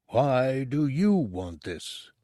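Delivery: slow, distant voice in the mind; mysterious monk voice. Acted. A monk's brain questions Bubo, slow and distant. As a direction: ask it slowly like an ancient brain.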